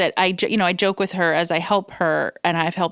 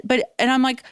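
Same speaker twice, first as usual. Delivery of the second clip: ordinary talk, nothing marked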